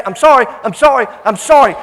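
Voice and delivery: loud repeated plea, acted out; insistent apology. Boy insists on being forgiven.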